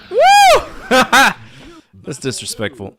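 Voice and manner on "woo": loud high whoop into laughter; whooping cheer. Real, not acted. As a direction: whoop with delight. Cheering a big play.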